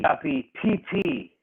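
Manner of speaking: high put-on Japanese accent, mock-serious; parodying a wrestler's catchphrase in a Japanese accent; imitating a Japanese wrestler. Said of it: "He once said on live T V".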